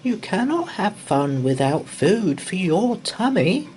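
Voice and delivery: high, lilting mother voice; a fussing mum reminding her boys; mummy's voice. A narrator's reading. Mum reminding the boys about lunch, high and lilting. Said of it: "said their mummy".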